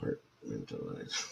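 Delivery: very low, hushed "compartmentalized"; trying the word. Real, not acted. Speaker retries the word he tripped on, low and quiet. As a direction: sound out "compartmentalized" softly to yourself. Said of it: "I couldn't say that word".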